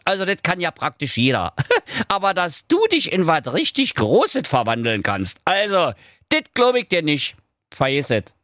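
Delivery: high, mocking little dog, goading the wizard; cheeky dog voice. Dog Doktor Schulz goads the evil wizard, high and cocky.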